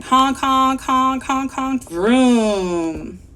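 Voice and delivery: honking cars. Acted. Teacher voices heavy street traffic, loud honking. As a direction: honk like cars, then rev off. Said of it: "what are the cars saying".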